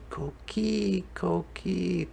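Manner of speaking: high, soft, sleepy murmur; small high near-whisper of a child; hushed little call. A narrator's reading. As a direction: sing it out quietly to yourself. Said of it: "Miguel whispered a quiet".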